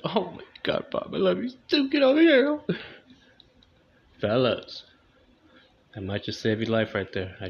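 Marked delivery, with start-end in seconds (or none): 0.1-2.6 swooning wife. Retold or imitated. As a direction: melt and pull him close. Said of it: "Is it your birthday?".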